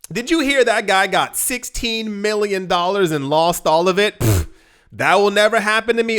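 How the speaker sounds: hammed-up gossip who thinks it could never happen to them; smug mockery. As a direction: sneer at the lotto winner with a scoff. Mimicking smug lotto mockers.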